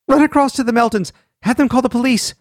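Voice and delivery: high, fast urgent shout. A narrator's reading. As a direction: yell the orders in alarm. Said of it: "Nettie shouted at William".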